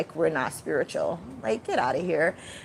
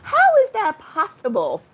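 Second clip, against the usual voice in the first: high, louder outraged question; indignant disbelief; 9 semitones higher, 6 dB louder than usual. Real, not acted. Ranting about the witchcraft, a high, louder indignant question. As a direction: throw the question at her in disbelief.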